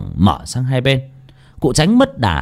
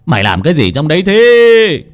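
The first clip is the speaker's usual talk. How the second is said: louder, higher furious demand; angry yell; 5 semitones higher, 7 dB louder than usual. Acted. Old master yelling at the girl inside. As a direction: bark at the girl behind the gate. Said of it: "lầu bầu chửi".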